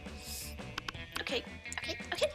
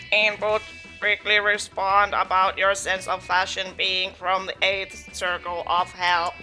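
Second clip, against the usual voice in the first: low, even robotic readout; 5 semitones lower, 3 dB louder than usual. Acted. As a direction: speak like a cold machine. Robot character reads insult.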